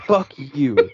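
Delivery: fuck you, half laughing, half crying; hurt laughing cry. Real, not acted. Hurt fan cursing the player with a laugh-cry. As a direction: spit it out through a laugh-cry. Ranting hurt about a broken promise.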